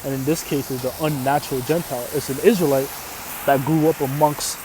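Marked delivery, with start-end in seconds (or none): none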